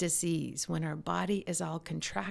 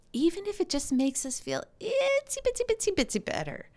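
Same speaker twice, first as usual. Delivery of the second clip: much higher, cutesy sing-song; playful high baby-talk voice; playful itsy bitsy; 13 semitones higher than usual. Acted. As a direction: squeak it out like something tiny. Playful tiny-amount baby talk.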